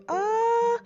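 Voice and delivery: usual speaking voice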